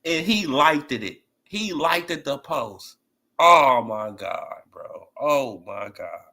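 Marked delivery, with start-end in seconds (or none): 3.4-6.2 slow, drawn-out low disbelief; stunned disbelief. Real, not acted. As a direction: let the disbelief drag out slowly.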